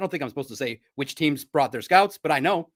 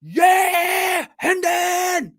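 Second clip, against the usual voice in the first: high, loud stadium yell of a name; loud fan shout of a name; imitating a fan's cheer; 12 semitones higher, 5 dB louder, much slower than usual. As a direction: belt it like a fan cheering a player. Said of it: "from his heart".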